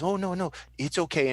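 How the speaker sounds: high-pitched reassuring character voice; Noella. Acted. Game master as Noella calming the old lady, high reassurance. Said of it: "And she goes".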